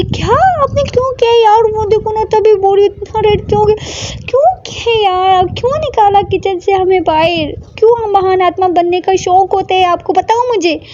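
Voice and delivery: high, loud exasperated scolding; worried scolding. Acted. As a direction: tell her off out of worry. Scolding partner who hurt herself. (चिंता भरी ऊँची डाँट)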